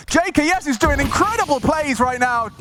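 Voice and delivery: hyped caster. Pretend. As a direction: sell the play like a big moment. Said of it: "maybe if I say".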